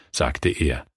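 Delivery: plain reading voice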